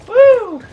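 loud celebratory whoop; high whoop. Real, not acted. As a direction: whoop in triumph.

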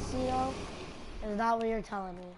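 ordinary talk

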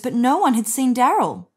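plain reading voice

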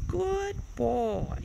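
high-pitched cooing praise; pet praise. Real, not acted. Owner praising the bird, high cooing pet talk.

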